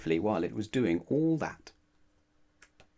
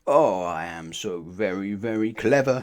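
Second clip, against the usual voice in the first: smug boast; 2 semitones lower, 4 dB louder than usual. Acted. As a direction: savour every word of the boast. Mister Clever boasting to himself.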